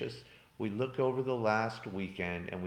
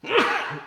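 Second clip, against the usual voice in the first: loud, sharp sneeze; a real sneeze bursting out; sudden sneeze; 12 dB louder than usual. Real, not acted. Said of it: "Oh, I'm gonna have to sneeze".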